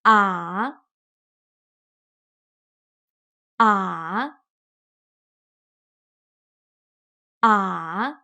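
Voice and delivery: A dipping low then rising; A dropping down then back up; third tone. Shown as a demonstration. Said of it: "Please read after me".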